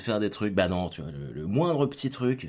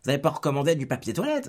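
nagging manager; 7 semitones higher, 5 dB louder than usual. Retold or imitated. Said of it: "c'était que des trucs à la con".

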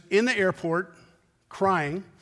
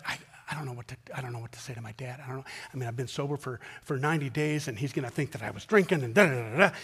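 much softer, fast troubled mumble; teary mumbling; 5 semitones lower, 5 dB quieter, much faster than usual. Retold or imitated. Sober young man fearing his dad, teary mumble. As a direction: mumble it, close to tears.

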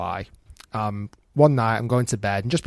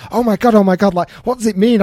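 higher, louder imitation of a panicking wife; freaking out; 8 semitones higher, 7 dB louder than usual. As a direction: mimic her flustered, excited outburst. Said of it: "She is freaking out".

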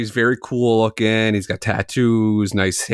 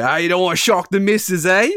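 quick, higher-pitched British barber imitation; cheeky question in a put-on British accent; 8 semitones higher, faster than usual. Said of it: "in the thickest British accent".

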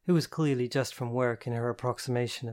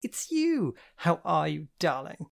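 mock surprise; 5 semitones higher, 3 dB louder, slower than usual. Continuing fake surprise.

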